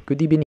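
"good evening" said as "goodee-benin"; coach's mispronunciation. Retold or imitated. Quoting the football coach, "good evening" mispronounced as "goodee-benin". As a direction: imitate an accented "good evening", v turned to b. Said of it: "he was saying".

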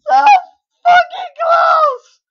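high, loud, slow yell; real anguished shout; shouted frustration. Real, not acted. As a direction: stretch it out in agony. Frustration after near success.

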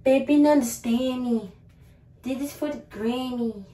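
ordinary talk

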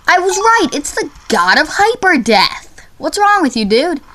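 high, swooping exasperation; indignant insistence. Real, not acted. Gamer arguing with the quiz over the final boss, indignant. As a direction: insist you were right all along. Insisting the answer was right.

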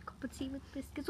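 soft British accent; quietly played British. Shown as a demonstration. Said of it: "British accent".

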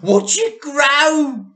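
high, loud, slow shout. A narrator's reading. Mr Cranky watching the hen, loud excited shout. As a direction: yell in triumph. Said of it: "shouted Mister Cranky".

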